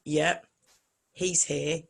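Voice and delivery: ordinary delivery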